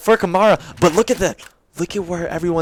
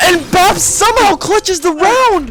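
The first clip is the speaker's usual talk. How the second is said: high, loud, stretched out call; excited clutch call; 13 semitones higher, 5 dB louder, slower than usual. Real, not acted. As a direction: sell the clutch moment.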